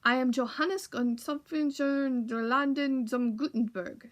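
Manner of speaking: put-on slow, grand introduction in a thick German accent. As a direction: announce yourself proudly, German accent. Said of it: "with a thick German accent".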